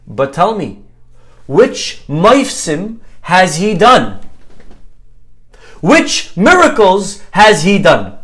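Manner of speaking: slow, loud, earnest question in imitation; sincere, naive. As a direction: mimic a kid asking in total earnest, drawing out each word. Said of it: "with the most sincere tone in his voice".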